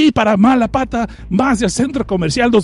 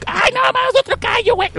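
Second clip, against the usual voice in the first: much higher, squealing mock panic; high comic panic; panicked crowd. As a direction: shriek like a fleeing pedestrian. Voicing fleeing crowd panic.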